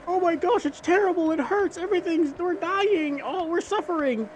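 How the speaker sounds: hammed-up high whine of suffering; mock wailing Israelites. Retelling the Bible, voicing suffering Israelites in a mock high whine.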